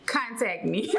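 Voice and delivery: ordinary talk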